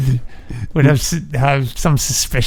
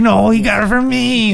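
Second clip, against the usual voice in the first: mock protest; 7 semitones higher than usual. Arguing ownership of line.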